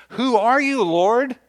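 high, loud, stricken cry to the unseen voice; startled cry. Acted. Saul, struck down on the road, crying out in alarm. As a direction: cry out like a man thrown from his horse.

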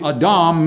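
"Adam" as ah-DAHM, stress on second syllable; Hebrew pronunciation. Shown as a demonstration. Preacher explaining what the Hebrew name means, "Adam" said ah-DAHM. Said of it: "would be pronounced more like".

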